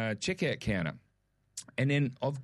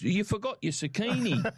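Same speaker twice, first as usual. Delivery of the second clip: higher, lightly voiced helpful stranger; the bloke in line; 8 semitones higher than usual. A stranger in the queue telling him what he left, pitched high. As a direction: pitch it up, cheerful and matter-of-fact. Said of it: "He goes".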